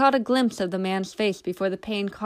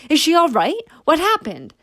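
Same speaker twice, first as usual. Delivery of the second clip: higher, louder worried questions; worried rush; 8 semitones higher, 6 dB louder than usual. A narrator's reading. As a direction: rush over in alarm. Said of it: "Hattie asked, quickly".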